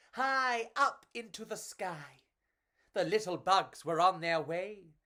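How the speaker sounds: put-on lively read-aloud for children; storybook narration. A narrator's reading. Reading a picture book to children, lively storytelling. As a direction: tell it like a bedtime picture book.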